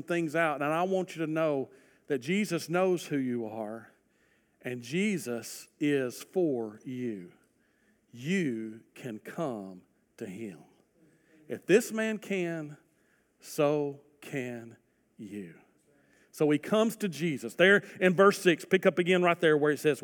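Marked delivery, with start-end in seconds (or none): 4.6-15.5 softer and slower, drawing people in gently; hushed pastoral invitation; tender appeal. Real, not acted. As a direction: invite the hidden listener softly. Tender appeal to hidden viewers.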